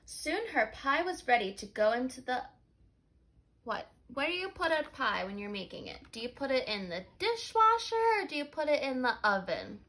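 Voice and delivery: normal talking voice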